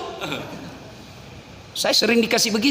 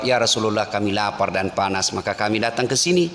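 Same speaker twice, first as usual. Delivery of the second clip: humble Umar; 11 semitones lower than usual. Acted. Umar voiced, lower.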